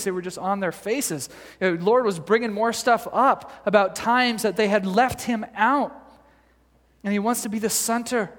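ordinary delivery

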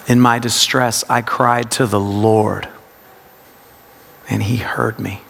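low meditative reading, weight on LORD; stress on LORD. Shown as a demonstration. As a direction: LORD held, long pause, hushed ending. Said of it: "it's a practice of word emphasis".

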